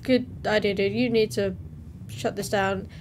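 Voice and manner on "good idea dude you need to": ordinary delivery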